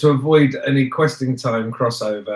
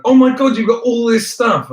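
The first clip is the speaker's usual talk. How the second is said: amazed; 9 semitones higher, 5 dB louder than usual. Retold or imitated.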